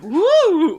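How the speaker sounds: high, loud imitated crowd "woo"; audience whoop. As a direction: whoop like a reacting cinema audience. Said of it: "people in the theater, like, reacting".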